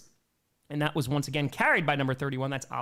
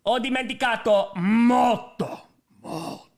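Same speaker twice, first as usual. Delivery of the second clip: slow, louder Italian accent; 7 semitones higher, 6 dB louder, slower than usual. Shown as a demonstration. Admitting to chat the Italian is rusty, slow Italian accent. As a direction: say it like a native, slowly. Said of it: "I took college Italian".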